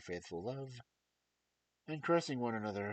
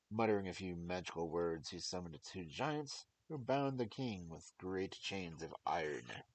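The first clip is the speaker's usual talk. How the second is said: ordinary delivery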